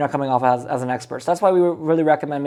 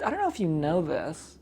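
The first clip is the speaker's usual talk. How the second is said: softer, confiding half-secret; dropped voice; 9 dB quieter than usual. Shown as a demonstration. Coach showing how to hook a seller, voice dropped softer. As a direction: drop the voice to share a secret. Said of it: "I drop my voice a little bit".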